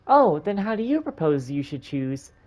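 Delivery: put-on little girl. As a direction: play an eager little girl.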